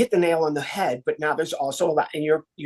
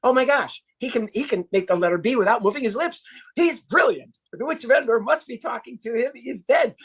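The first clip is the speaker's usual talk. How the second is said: mock awe; 6 semitones higher than usual. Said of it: "awestruck ancient audience".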